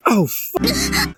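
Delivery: very high cut-off curse; startled curse. A narrator's reading. Jack Skeleton startled, very high cut-off curse. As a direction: yelp it. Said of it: "said Jack".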